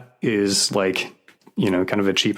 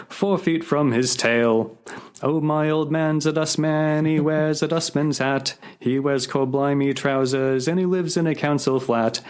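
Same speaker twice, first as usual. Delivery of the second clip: sing-song recited verse, music-hall lilt; cheery recitation of a Cockney ditty; comic song recital; 6 semitones higher than usual. Acted.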